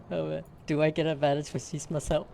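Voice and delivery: ordinary talk, nothing marked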